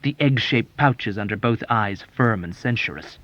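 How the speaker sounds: ordinary delivery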